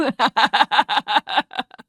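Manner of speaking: rising mocking villain laugh; villain's laugh. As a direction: let a gloating laugh climb upward. Villain mocking silly notion.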